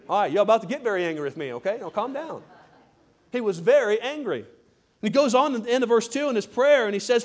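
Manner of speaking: normal talking voice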